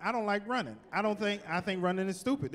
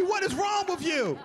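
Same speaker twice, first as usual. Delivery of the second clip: higher, laughing exasperation; exasperated yell; 9 semitones higher, 3 dB louder than usual. Pretend. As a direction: yell at the screen in disbelief. Scolding movie victim.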